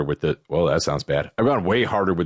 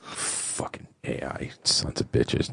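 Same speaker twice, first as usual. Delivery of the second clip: whispered curse, much softer; cursing under breath; 6 semitones lower, 7 dB quieter, slower than usual. Real, not acted. Host annoyed at his own intro mistake, whispered curses. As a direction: hiss the swear words to yourself.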